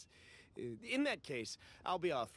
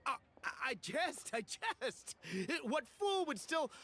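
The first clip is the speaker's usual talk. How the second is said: nervous; 9 semitones higher than usual. Acted. Merchant hastily backpedals, nervous.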